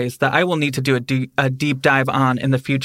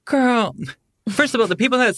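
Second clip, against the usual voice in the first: put-on sassy girl; 9 semitones higher than usual. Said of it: "I'm like".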